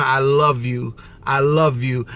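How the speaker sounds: low, calm, tender reassurance; loving dad. Retold or imitated. Smiling dad answering his upset daughter, tender and low. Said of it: "he's just smiling at her".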